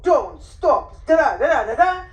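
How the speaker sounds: don't, stop, blah blah scold; scolding command. Shown as a demonstration. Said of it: "So when you say to the dog".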